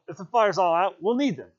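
loud, high urgent call; shouting. Acted. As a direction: keep shouting the reason. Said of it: "shouted Ralph".

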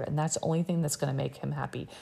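usual speaking voice

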